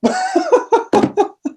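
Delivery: high-pitched burst of laughter; real laugh at one's own story. Real, not acted. Laughing at own retold scare.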